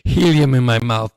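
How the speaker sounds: amused, squeaky processed voice; helium voice. Real, not acted. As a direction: talk as if you inhaled helium. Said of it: "sounds like I have helium".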